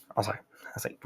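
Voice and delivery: ordinary talk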